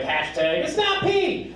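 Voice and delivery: high, slow protest cry; acted hashtag rallying cry; outraged slogan. Voicing outraged online backlash.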